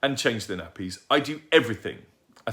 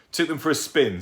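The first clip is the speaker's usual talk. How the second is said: gleeful boast; 7 semitones higher, 6 dB louder than usual. Acted. Lancelot's cheerful boast.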